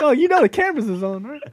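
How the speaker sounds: high, mock-knowing jab; teasing aside. Ribbing the kid showing off, high teasing. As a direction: needle him playfully.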